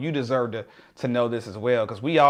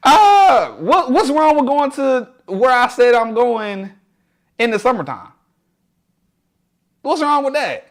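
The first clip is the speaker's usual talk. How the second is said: incredulous; 8 semitones higher, 4 dB louder than usual. Real, not acted. Reacting to chat disapproval.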